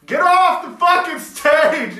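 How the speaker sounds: loud high heckling yell; mock heckle. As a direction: yell it like an angry crowd. Crowd heckling Gene.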